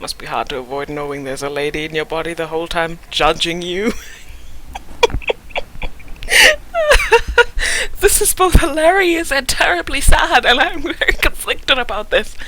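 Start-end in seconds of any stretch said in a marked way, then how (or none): 4.0-7.9 real high laugh that tips into a squeal; a real laugh she can't hold back; high, helpless real laughter. Real, not acted. Players crack up at the scene. 8.0-12.3 laughing. Real, not acted. Laughing reaction after the scene.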